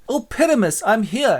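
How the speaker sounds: high-pitched swooning stage lover; hammy Thisbe. Acted. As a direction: answer in mock-romantic high voice. Thisbe answers in amateur play.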